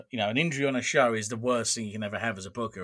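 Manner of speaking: ordinary talk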